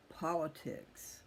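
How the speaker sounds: ordinary delivery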